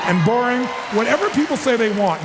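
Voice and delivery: ordinary talk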